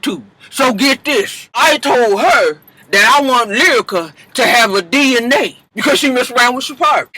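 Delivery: a mother dishing gossip with relish; gossiping Mama Dap. Acted. Mama Dap continues her story.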